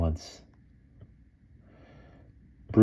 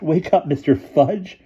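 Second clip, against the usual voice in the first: laughing; 12 semitones higher, 3 dB louder than usual. A narrator's reading. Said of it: "Boots laughed".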